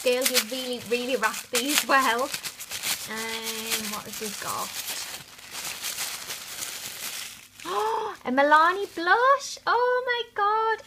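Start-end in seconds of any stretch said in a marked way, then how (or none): 4.7-8.2 excited gasp. Real, not acted. Unboxing a surprise blush. 8.2-10.8 high, loud delighted squeal; thrilled gasping delight; excited. Real, not acted. Delighted by the blush.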